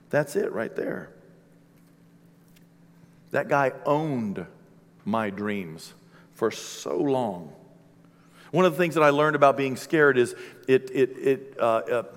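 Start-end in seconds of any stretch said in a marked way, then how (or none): 0.1-1.1 whispered "that's it right there", soft and breathless; hushed whisper. Real, not acted. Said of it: "And it's like, yeah". 3.3-7.5 quiet confession. Real, not acted. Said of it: "confessing his fear quietly".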